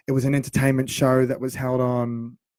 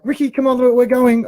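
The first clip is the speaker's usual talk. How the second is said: high, loud hurry-up call; urgent publicist; 10 semitones higher, 7 dB louder than usual. Retold or imitated. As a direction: rush him out the door, high and loud. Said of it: "the publicist is like".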